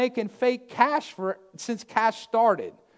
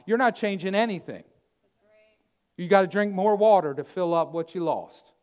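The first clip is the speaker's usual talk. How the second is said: ordinary talk, nothing marked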